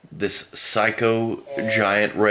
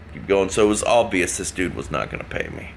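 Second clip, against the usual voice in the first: usual speaking voice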